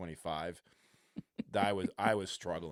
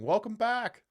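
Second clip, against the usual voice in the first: high, loud cheering holler; retold yell; 13 semitones higher, 8 dB louder, slower than usual. Fan retelling a shout to the returning pitcher, yelling. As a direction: call it out like a fan in the stands. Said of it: "I remember yelling".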